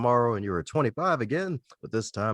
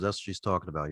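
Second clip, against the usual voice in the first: usual speaking voice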